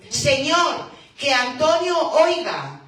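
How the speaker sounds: loud, high pleading prayer; crying out in prayer. Acted. Congregation pleading with the Lord for Antonio's hearing, loud cry. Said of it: "seguimos clamando".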